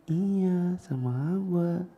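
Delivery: put-on soft, high, drawn-out child voice; reply small and hushed like a child; Umar. Small boy following adult's quiet voice, soft and high. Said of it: "dia pun otomatis ngikut".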